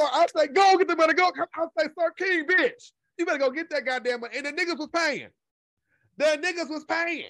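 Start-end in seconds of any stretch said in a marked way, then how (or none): 0.5-1.3 loud catchphrase. Retold or imitated. Telling Sir King off. 2.0-4.4 sassy scolding. Retold or imitated. Telling Sir King off.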